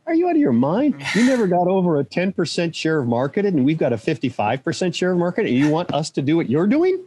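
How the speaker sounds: high-pitched, indignant retold comeback; incredulous retort. American boss answering Sega of Japan's request, incredulous retold retort. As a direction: imitate yourself arguing back in disbelief.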